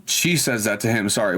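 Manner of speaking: usual speaking voice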